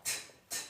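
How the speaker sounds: "t, t" puffed out with no voice; voiceless t sound. Shown as a demonstration. Teacher isolating the final T of "washed". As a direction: tap a voiceless t.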